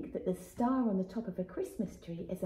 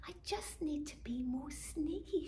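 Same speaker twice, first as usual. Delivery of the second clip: high hushed whisper of a plotting little bird; sneaky whisper; 6 semitones higher, 6 dB quieter than usual. Acted. Robin whispers her plan to be sneaky. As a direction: whisper it like sneaking past a sleeping house.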